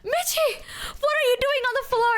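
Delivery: put-on high, wailing drunk voice; drunk friend. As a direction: imitate a tipsy friend calling out in alarm. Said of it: "also drunk".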